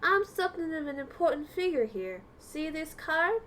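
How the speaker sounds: put-on slow, proud country accent. Rabu bragging about his stamp card, in a slow country accent. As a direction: take your time, like a self-important country regular. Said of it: "so he does have a Kansai accent".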